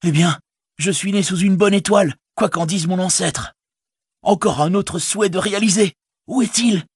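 high excited hobbit voice; bursting with joy; delighted Sam. Acted. Sam asking where his pony is, high and delighted.

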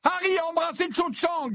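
very high, overwrought shout; high-pitched distressed outburst; near-tearful cry. A narrator's reading. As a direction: cry out on the verge of tears. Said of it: "s'écria Ginny, qui semblait à présent au bord des larmes".

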